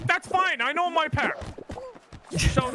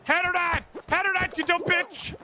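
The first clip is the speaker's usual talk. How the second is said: angry yell; 8 semitones higher than usual. Real, not acted. Yelling at teammate to score.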